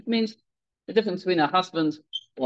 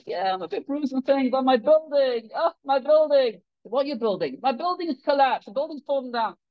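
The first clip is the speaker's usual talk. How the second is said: mock sobbing; 7 semitones higher than usual. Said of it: "he's sobbing uncontrollably".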